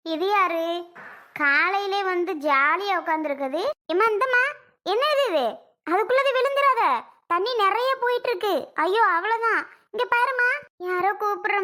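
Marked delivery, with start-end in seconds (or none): 3.9-6.8 alarmed calling out. Acted. Neighbour warns woman near river.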